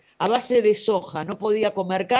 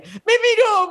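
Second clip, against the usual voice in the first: much higher, tearful-sounding mother voice; imitating mom; 13 semitones higher, 6 dB louder, slower than usual. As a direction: play the mother near tears, high and pleading. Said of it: "me decía". (imitando a la mamá emocionada, voz muy aguda)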